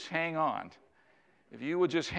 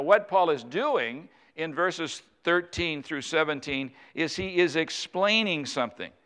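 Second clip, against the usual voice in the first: usual speaking voice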